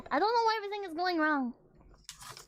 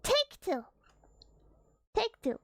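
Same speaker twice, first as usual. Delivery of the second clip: ordinary delivery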